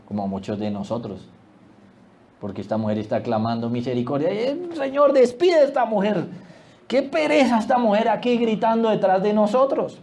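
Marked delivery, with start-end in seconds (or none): 4.3-10.0 high-pitched weary complaint; fed-up. Acted. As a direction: act out an exasperated plea to be rid of someone. Discípulos hartos quejándose de la mujer. (queja actuada, aguda y harta)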